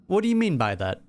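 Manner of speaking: normal talking voice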